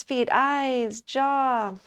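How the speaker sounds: normal talking voice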